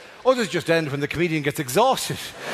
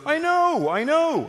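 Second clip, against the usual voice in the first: mild Dublin driver; 9 semitones higher, much slower than usual. Retold or imitated. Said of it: "your man goes".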